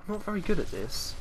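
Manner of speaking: self-mocking high voice. Real, not acted. Embarrassed gamer mocking her own speed, in a high voice. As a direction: squeak it out, embarrassed. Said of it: "I always seem to speed up".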